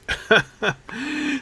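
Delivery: short, high laugh; amused chuckle. Real, not acted. Host laughing after a dig at the government, amused chuckle. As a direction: chuckle at your own jab.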